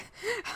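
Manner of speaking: a short, soft laugh that jumps high. Real, not acted. Laughing softly while comforting a crying partner. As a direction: let a quick laugh slip out.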